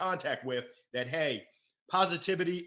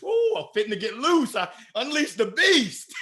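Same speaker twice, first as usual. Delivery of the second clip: loud, high whoop breaking into laughter; wild release; 8 semitones higher, 8 dB louder than usual. Retold or imitated. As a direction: cut loose in gleeful celebration. Off-duty self breaking loose.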